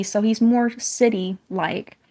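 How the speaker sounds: usual speaking voice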